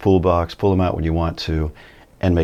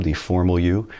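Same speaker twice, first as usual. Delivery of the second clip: ordinary talk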